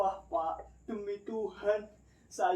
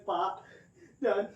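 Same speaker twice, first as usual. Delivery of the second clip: normal talking voice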